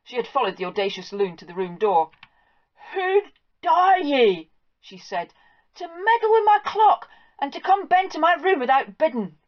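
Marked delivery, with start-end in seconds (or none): 2.9-9.4 high, loud Scots housewife scolding; shrill-pitched outrage at the farm boy; indignant farm wife. Acted. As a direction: demand it like an outraged mistress of the house. Mistress confronts the loon.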